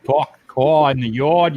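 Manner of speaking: put-on slow, loud Boston accent with the r's dropped. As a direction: lay the Boston accent on thick, slowly. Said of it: "that's where I get my Boston accent".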